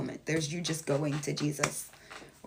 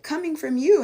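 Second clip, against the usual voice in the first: mocking people; 9 semitones higher, 9 dB louder than usual. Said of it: "there's so many people that be like".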